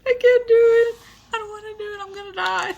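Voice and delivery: high, melodramatic wailing; hammed-up high whine of giving up; dramatic despair. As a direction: play it up like a tragic heroine. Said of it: "very, like, dramatic".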